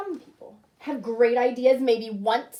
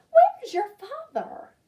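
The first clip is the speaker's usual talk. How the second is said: mother asks; 12 semitones higher, 8 dB louder, slower than usual. Acted. Mother asking, suspicious.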